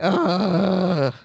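very slow, drawn-out wail of woe; theatrical mock grief, slow and dragged; mock lament. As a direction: ham up the suffering, stretching every word. His mock lament at the disaster.

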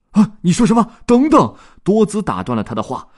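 very high, loud cry; excited shout. Acted. Dodds recognizing his duck, a loud high cry. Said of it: "他禁不住大叫了起来".

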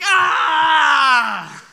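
put-on high, loud laughing yelp of a spanked kid; mock toddler shriek. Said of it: "He's like".

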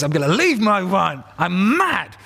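hammed-up sulky king voice; mock angry king. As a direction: play the king's huff as a comic pout. King storms off in rage.

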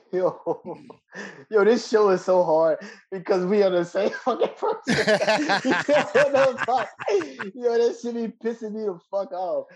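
high, loud excited laughing rant; laughing at being the same person; laughing excitedly. Real, not acted. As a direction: laugh while ranting, fired up.

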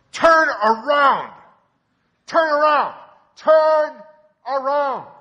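shouted loud and drawn out, high; drunk man yelling. Retold or imitated. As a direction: bellow it across the church. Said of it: "He's going".

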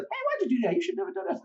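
high-pitched mock mother scolding; imitating mom. Son imitates his mother scolding him, lightly high. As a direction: play the nagging mom, up high.

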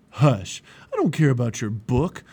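loud, stubborn dismissal; dismissive refusal. A narrator's reading. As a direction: cut them off loudly. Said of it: "But Obstinate refused".

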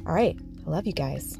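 normal talking voice

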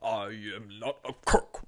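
slow, drawn-out presidential impression; slow caricature of a disgraced president; Richard Nixon. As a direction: stretch each word like a caricatured politician. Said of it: "I know what Nixon sounds like".

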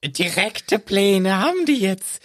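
high squeaky elf voice; house-elf voice. Pretend. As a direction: play a squeaky little elf. Creature role-play with Voldemort.

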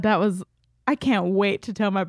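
ordinary talk

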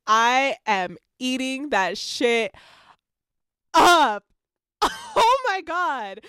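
high, loud, slow squeal; screaming with book joy; ecstatic shriek. Real, not acted. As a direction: shriek with glee on up. Gushing over the male scenes.

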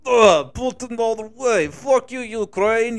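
loud, hammed-up fake Russian accent; mock Russian accent. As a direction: taunt in a put-on Russian accent. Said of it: "fake that you Russian accent there".

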